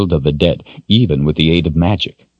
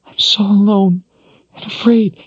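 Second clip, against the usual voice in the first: crying. Acted. Crying woman repeats lament.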